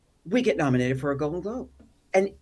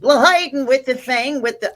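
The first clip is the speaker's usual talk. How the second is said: nervous Jerry Lewis; 8 semitones higher, 9 dB louder than usual. Retold or imitated. At the red carpet, flustered near celebrities, high loud nervous babbling. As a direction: babble like a starstruck nervous wreck. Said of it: "I turn into Jerry Lewis a lot".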